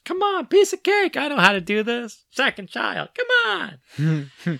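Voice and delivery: high-pitched, breezy mock confidence; cocky self-assurance. Cocky self-pep talk retold.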